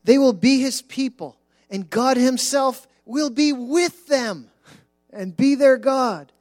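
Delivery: solemn, swelling Bible reading over music; scripture reading. Acted. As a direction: proclaim the verses from the pulpit.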